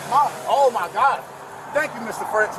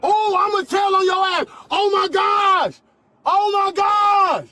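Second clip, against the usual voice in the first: high furious shouting, slow; berserk rage; 6 semitones higher, 4 dB louder, slower than usual. Real, not acted. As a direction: yell it out in a rage. Thrown to the floor, yelling.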